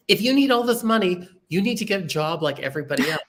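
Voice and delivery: imitated fatherly ultimatum; retold stern father. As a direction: lay down the law like a father.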